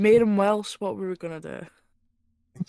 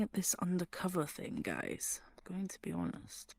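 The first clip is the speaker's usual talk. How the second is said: unvoiced aside to the party; whispering; 12 dB quieter than usual. Real, not acted. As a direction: whisper it so the shopkeeper won't hear.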